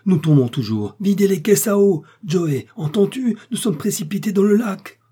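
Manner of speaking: high, loud alarmed orders; urgent shouting. A narrator's reading. Doctor warning Joey as they plunge towards the lake, urgent shouting. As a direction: call out urgently, climbing high and staying there.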